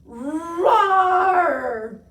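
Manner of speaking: huge, long, high dinosaur roar; loudest roar. A narrator's reading. Tiny roaring to shake down the snow, enormous roar. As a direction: roar as loud as possible. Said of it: "roared his loudest roar".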